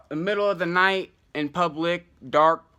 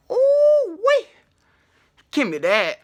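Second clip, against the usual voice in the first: a high squealing "ooh, wee", then grabby; excited squeal; 7 dB louder, slower than usual. Retold or imitated. Tripping man spotting joints on the ground, squealing with glee. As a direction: squeal with greedy delight at a find.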